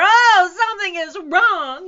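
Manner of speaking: very high, loud alarmed cry; frantic zookeeper's yell; shouted. A narrator's reading. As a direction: shout it in a panic. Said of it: "he shouted".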